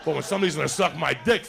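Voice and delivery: normal talking voice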